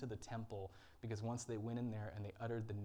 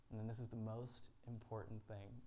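ordinary talk

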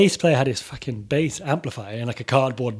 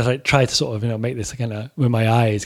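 ordinary talk

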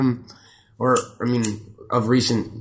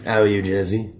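lower, odd put-on character voice; 6 semitones lower than usual. Host greeting his co-host in a put-on lower voice. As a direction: greet your co-host in a silly low voice. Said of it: "you gonna do the whole thing like that?".

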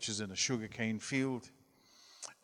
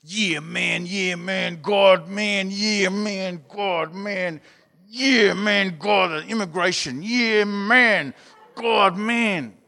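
put-on louder, higher "yeah, man, God, man" chant of teenage boys; emphatic boys' prayer; 7 semitones higher, 11 dB louder, much slower than usual. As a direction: pump it up like eager boys praying hard. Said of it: "they're much more emphatic".

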